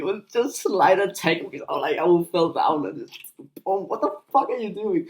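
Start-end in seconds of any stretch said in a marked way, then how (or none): 3.6-5.1 high, startled, helpless protest from the floor; weak startled cry. Retold or imitated. Tackled kid protesting feebly to the bully, high and startled. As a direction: squeak it out like the tackled kid who can't push back. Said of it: "I wouldn't even say that".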